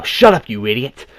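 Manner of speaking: exasperated mock outburst, stretched out; acted angry yell. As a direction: yell it at a panicking friend. Acted snap at panicking friend.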